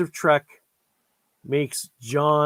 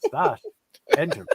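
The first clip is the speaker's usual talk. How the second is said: pitched up, then dropped on Enterprise; Shatner's list reading; 10 semitones higher than usual. Retold or imitated. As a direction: read it like two list items. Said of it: "like he's reading two different items in a list".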